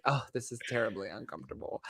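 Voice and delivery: hushed, quick character aside; soft, hurried mock discomfort; Sarah Sanderson. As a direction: lightly imitate an uneasy movie witch, under the voice. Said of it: "like, Sarah Sanderson in Hocus Pocus".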